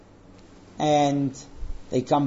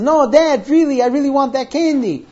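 put-on higher, louder child's plea; acted pleading boy; 7 semitones higher, 7 dB louder than usual. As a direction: argue insistently like a boy begging Dad. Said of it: "he starts arguing to and fro".

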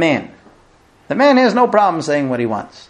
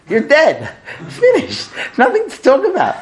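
much higher, laughing mock verdict; mock teasing husband; 8 semitones higher than usual. Husband teasing his wife about her weight, much higher and laughing. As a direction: ham up the tactless husband.

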